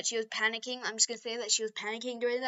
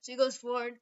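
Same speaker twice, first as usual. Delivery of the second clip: normal talking voice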